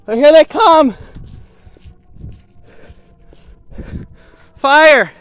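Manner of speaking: loud, urgent calling out; excited shout. Real, not acted. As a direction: shout it as the trucks arrive. Fire trucks arriving, calling out.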